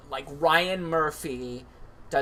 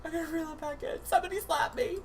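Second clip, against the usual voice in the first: mock teary plea; 13 semitones higher, much faster than usual. Pretend anchor crying.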